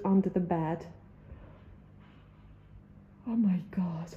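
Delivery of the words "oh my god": breathless whisper; a real frightened whisper. Real, not acted. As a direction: barely voice it, in awe and fear. Alone, tense.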